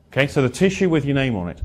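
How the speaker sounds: ordinary talk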